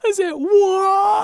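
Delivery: very high, loud, slow echo; hammed-up drawn-out jeer; mocking taunt. Co-host echoing the taunt about Walt, loud high mockery.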